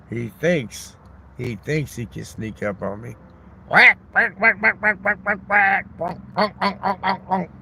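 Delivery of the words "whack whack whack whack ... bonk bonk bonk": mock whack sounds. Sound effects hitting the duck.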